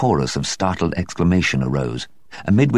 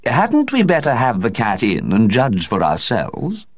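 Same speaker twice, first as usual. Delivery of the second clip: slow, higher hostess voice; slow raised lady's suggestion; suggesting hostess; 6 semitones higher, slower than usual. A narrator's reading. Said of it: "suggested Lady Blemley".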